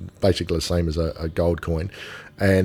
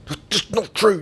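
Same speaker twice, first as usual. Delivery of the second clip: much higher put-on protest voice; retold protest; 12 semitones higher than usual. Husband to his wife at the movies, high protest. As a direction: squeak out the objection. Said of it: "I'm saying to my wife".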